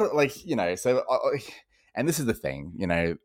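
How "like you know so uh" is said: usual speaking voice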